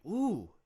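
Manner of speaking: sharp wince at the words; a high, loud ooh of hurt; mock stung groan; pained ooh. Reaction to mom's words.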